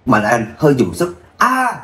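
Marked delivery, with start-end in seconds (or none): 1.4-1.8 high, piercing wail of agony; agonized scream. Acted. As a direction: scream in terrible pain. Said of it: "tiếng gào thảm thiết".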